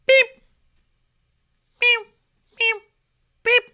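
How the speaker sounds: short clipped beeps and meows like a caged bird; silly mock bird beep and meow; mock bird noises. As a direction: imitate a dumb pet bird beeping. Mimicking a caged bird's noises.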